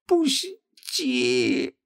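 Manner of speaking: high, choked, strangled plea; barely audible wheeze. A narrator's reading. Wife being strangled begs husband, faintly wheezing. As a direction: squeeze the word out as if throttled. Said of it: "чуть слышно просипела".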